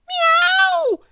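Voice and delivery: very high, loud meow; cat screech. Acted. Cat sat on in the heap, screeching. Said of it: "screeched the cat".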